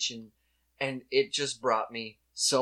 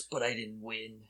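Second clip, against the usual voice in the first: mock mopey baker; 3 semitones lower, 10 dB quieter than usual. Baker repeats his loss.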